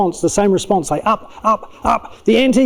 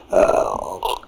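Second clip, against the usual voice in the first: very low, drawn-out "ugh"; wounded, exasperated groan; disgusted groan; 5 dB quieter than usual. Acted. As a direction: groan low in the throat, offended. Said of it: "And I go".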